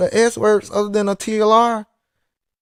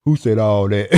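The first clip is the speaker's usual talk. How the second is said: low, put-on voice playing innocent; mock denial, low and offhand; imitating his friend; 8 semitones lower than usual. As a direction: play dumb like the friend would. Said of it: "This is what he say".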